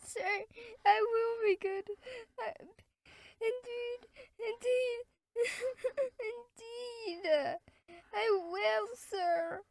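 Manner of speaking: put-on high child voice breaking into sobs; sobbing child. Boy begging the beadle, crying. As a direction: plead through tears, slowly. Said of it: "sobbed Oliver".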